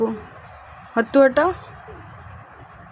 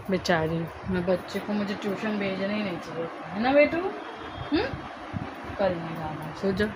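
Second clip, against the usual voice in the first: much softer, tender murmur; soft soothing; 3 semitones lower, 8 dB quieter than usual. Real, not acted. Mother softly promising her child no tuition, hushed. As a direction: lull her gently, barely above a breath.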